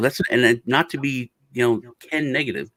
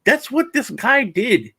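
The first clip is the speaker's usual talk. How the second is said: high, slow mock voice; 9 semitones higher, slower than usual. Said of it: "I was like".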